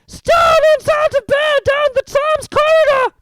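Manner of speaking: raucous sprite raising the alarm; bellowing poltergeist. A narrator's reading. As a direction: bellow it down the corridor. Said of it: "Peeves bellowed".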